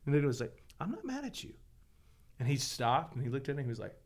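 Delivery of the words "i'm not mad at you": much higher and softer, tender and reassuring; gentle reassurance. Retold or imitated. Dad softly reassuring his upset foster son. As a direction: soothe a crying boy you hold.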